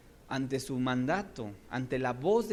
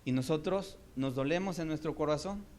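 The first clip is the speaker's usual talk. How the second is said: usual speaking voice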